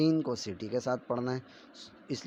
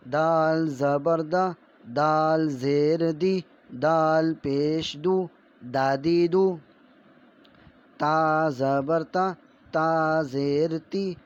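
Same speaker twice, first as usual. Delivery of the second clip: slow, loud sing-song recitation of letter sounds; syllable drill; 4 semitones higher, 5 dB louder, much slower than usual. Shown as a demonstration. Teacher drilling children in Urdu letter sounds, slow and loud. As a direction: chant each syllable slowly like a classroom primer.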